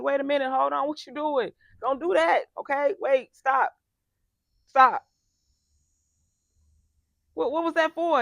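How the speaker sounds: loud, high alarmed yelling to make someone stop; alarmed shouting. Retold or imitated. Coach reacting to a client swinging at her, loud alarmed shouting. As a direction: shout them off, startled and alarmed. Acting out reaction to being swung at.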